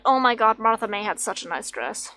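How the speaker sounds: ordinary talk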